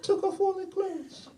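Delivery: very high, squeaky put-on cat voice; high-pitched cat confiding gossip; talking cat. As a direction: tell tales as a pet cat. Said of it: "the cat is actually saying to me".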